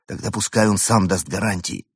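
plain reading voice

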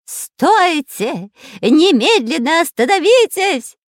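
high, loud villain's yell; Дырка's creepy shout. A narrator's reading. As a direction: yell a menacing order as a villainous spy. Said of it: "кричал жутким голосом шпион Дырка". (жуткий крик злодея)

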